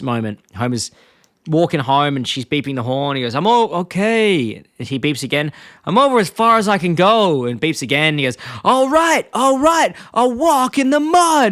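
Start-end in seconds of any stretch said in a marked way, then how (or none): 3.3-4.5 higher, drawn-out put-off reply; retold Homer protest. Retelling Homer being beeped at on the road, put-on protest. 5.8-7.4 higher, louder put-on cartoon protest; Homer. Retelling Homer being beeped at again, put-on protest. 8.6-11.5 very high, loud cartoon exasperation; hammed-up yell of giving in; Homer. Retelling Homer giving in to the honking, exasperated imitation.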